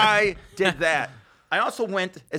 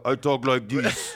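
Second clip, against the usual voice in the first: Brian overcompensating; 2 semitones lower, slower than usual. Retold or imitated. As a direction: drag it out in a put-on manly voice. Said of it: "he made sure to overcome".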